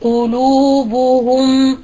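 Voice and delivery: قلوبهم drawn out slowly with rounded lips; careful model of قلوبهم; slow demo word. Shown as a demonstration. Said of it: "نحو".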